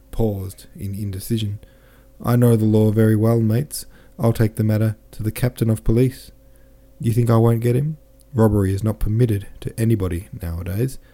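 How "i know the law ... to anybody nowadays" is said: plain reading voice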